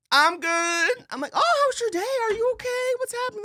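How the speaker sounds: very high, bright falsetto questions; high chirpy check-in. Retold or imitated. Greeting a stranger on the verge of tears, high chirpy falsetto. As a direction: chirp it in a sky-high sing-song. Imitating a chat with someone sad.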